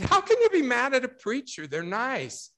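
high, loud incredulous protest; retold disbelief. Speaker to upset bride who blames the preacher, incredulous. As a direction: exclaim it in mock astonishment.

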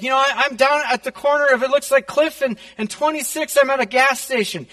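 high-pitched retold fright; scared, crying. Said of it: "she's just scared. She's crying".

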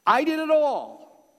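"I did it all" high, loud and slow; emphatic declaration. Acted. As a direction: proclaim it as God, stretching each word. Voicing God's emphatic declaration.

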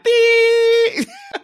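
long, loud, high monotone beep; flatline beep. Retold or imitated. Imitating the missing cardiogram flatline, long high beep. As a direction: hold one piercing note like a heart monitor. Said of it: "the cardiogram with the flat line".

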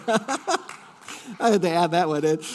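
slow words laughed through; laughing after teasing the congregation about the son-in-law; laughing aside. Real, not acted. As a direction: chuckle it out as a self-deprecating aside. Laughs after joking about himself.

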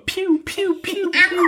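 squeaky high piu piu blaster noises; high piu shots. Acted. Bamse shooting water at the skeletons, squeaky piu sounds. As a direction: fire off tiny high zaps.

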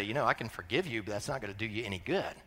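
low, soft, quick answer; quiet pastoral reply. Retold or imitated. Pastor replies to confessing spouse, hushed and low.

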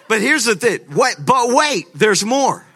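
loud, high-pitched TV pitchman tease; excited salesman holding out a bonus; infomercial hype. Acted. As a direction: sell it like a late-night infomercial.

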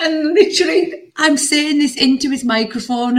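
high giggling recount; laughing. Real, not acted. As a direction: giggle while you tell it. Laughing while telling.

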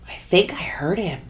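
soft unvoiced whisper. [name] tells her friend she heard him, whispered. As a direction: hush it as if afraid to say it aloud. Tentative reply on the phone.

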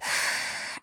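short imitation of a heavy breather; heavy breathing. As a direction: breathe out heavily without voice, mimicking him. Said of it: "all you can hear is".

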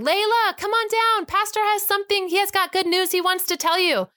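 high sustained calling-out voice; re-enacted yell, high and carrying; retold yelling. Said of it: "I start yelling".

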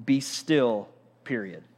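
quiet, firm two-word commands, a clear stop after each; Jesus commanding the storm. Retold or imitated. Preacher voicing Jesus hushing the storm, quiet and firm. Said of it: "Mark says it like this".

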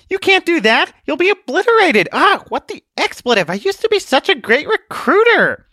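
panicked protest. Acted. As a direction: shriek in comic panic as the recruit walks off. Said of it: "the purple-haired girl's protest".

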